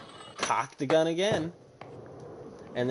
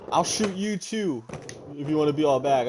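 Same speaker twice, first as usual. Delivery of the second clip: retold threat; 2 semitones higher, 3 dB louder than usual. Neighbour threatens with shotgun.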